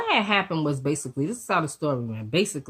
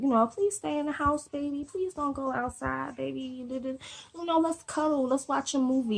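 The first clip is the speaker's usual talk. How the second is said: put-on high, soft, babyish begging; sweet pleading; 10 semitones higher, 5 dB quieter than usual. Girlfriend begging her man to stay home and cuddle, high soft pleading. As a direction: coax him sweetly to stay in, higher and softer.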